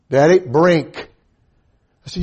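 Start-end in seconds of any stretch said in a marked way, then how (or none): 0.1-1.1 put-on slow, louder toddler's demand; louder child's plea. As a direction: call it out louder like an insistent little boy.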